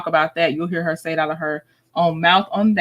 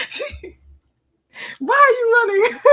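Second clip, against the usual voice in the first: high, teary laugh-squeak; cry-laughing; 14 semitones higher than usual. Real, not acted. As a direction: crack up while quoting. Host laughing at clip.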